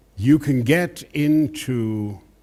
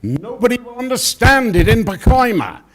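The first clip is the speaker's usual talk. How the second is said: high, loud mock director outburst; a boss waving off the actor; impersonating the director; 11 semitones higher, 8 dB louder than usual. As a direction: belt it like an exasperated director. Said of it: "And he said".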